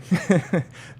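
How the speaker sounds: a quick bright burst of laughter; short high laugh. Real, not acted. Tarot reader laughing to her viewers, a short real laugh. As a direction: let a real laugh slip out.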